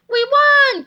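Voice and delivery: high, loud victory shout; triumphant cheer. Acted. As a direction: crow over the win. Heroes triumph.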